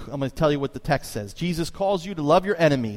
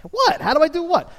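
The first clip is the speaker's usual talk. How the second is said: much higher, louder disbelieving reply; baffled; 7 dB louder than usual. Retold or imitated. Young waiter to mocking coworker, baffled and high. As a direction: squeak out your confusion.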